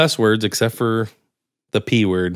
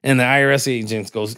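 ordinary talk, nothing marked

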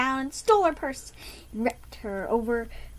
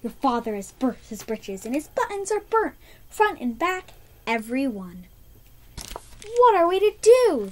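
higher, louder anxious mother; alarmed mother; 6 semitones higher, 5 dB louder than usual. Acted. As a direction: fret to the daughters. Mother voiced in story.

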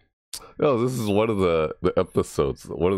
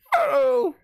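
very high, stretched falsetto hello; taunt impression; 3 dB louder than usual. Gamer trying to mimic a taunt, falsetto impression. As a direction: squeal it out as an impression. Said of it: "Do these impressions".

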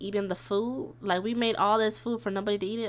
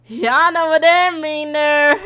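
loud, raised, playful hollering; suggestive teasing; 5 semitones higher, 10 dB louder than usual. Real, not acted. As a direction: holler the hint higher and louder. Teasing hint about the flirting.

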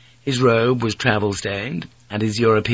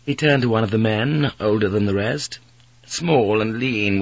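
ordinary delivery, nothing marked

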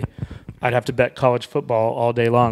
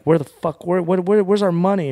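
retold screaming; 7 semitones higher than usual. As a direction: yell it at your father, pitch climbing on each where. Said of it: "we were screaming at him".

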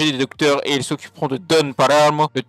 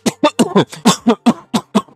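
grosse quinte de toux forcée; toux de personnage; 9 semitones higher, 6 dB louder than usual. Acted. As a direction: tousse bruyamment comme un parrain malade. Quinte jouée du parrain malade. (quinte sonore et théâtrale)

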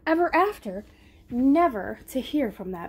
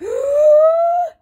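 loud, long, high sucked-in breath; big inhaling gasp; 9 dB louder than usual. Acted. Wolf gathering breath to blow, loud inhale. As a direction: suck in a huge breath.

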